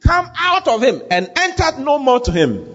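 high, ringing exorcism command; preacher acting out a commanding rebuke; stern rebuke. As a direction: order the spirit out with authority. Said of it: "He rebuked the foul spirit".

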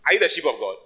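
ordinary delivery

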